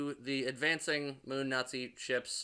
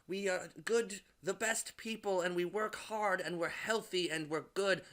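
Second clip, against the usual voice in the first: mock proud teacher; 5 semitones higher than usual. Summarizing the film's speech, in a lightly mocking preachy voice. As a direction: parody a proud propaganda speech.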